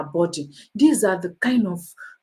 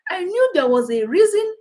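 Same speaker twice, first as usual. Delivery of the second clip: gloating commenter; 9 semitones higher than usual. Retold or imitated. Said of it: "I'll just tell you what the guy said".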